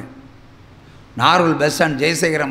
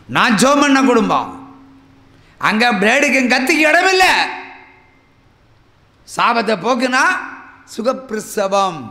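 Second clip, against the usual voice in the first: higher, loud triumphant proclamation; fiery preaching; 6 semitones higher, 4 dB louder than usual. Acted. Preacher to congregation about his praying family, fiery proclamation. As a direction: declare victory over the curse.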